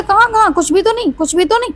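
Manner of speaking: high, hurried nervous denial. Acted. Shubh denying the blood on his clothes, nervously high. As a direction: brush it off too quickly, voice jumping up. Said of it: "इस तरह से कहता है".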